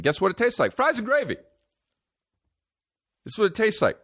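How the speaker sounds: hammed-up high indignation; exasperated rant. Comedian insisting poutine is just fries and gravy, exasperated. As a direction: climb very high in comic outrage.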